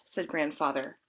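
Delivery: usual speaking voice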